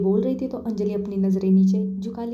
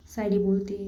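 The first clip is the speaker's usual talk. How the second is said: plain reading voice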